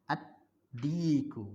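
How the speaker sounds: addiku very slow, doubled d; lam silent. Shown as a demonstration. Teacher models addiku for students, very slow. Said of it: "lam yang nggak dibaca".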